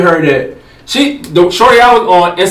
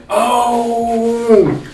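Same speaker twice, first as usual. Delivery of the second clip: long drawn-out, higher-pitched; dawning realization; 6 semitones higher than usual. Retold or imitated. Man remembering after an hour of thinking, drawn-out realization. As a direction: act out the penny finally dropping, stretched out.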